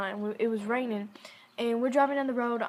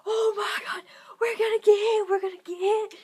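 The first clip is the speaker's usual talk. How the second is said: high, loud frantic whispered scream; panicked screaming; 10 semitones higher, 5 dB louder than usual. Retold or imitated. Narrator to grandmother as a train approaches, panicked scream. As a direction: freak out like the train is about to hit. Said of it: "I start freaking out".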